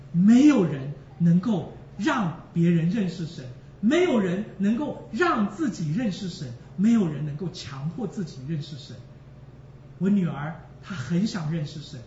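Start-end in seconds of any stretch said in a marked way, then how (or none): 0.1-9.0 each 没有人 line driven home and falling; emphatic preaching. Real, not acted. Preacher drives the point home to the congregation, stressing 没有.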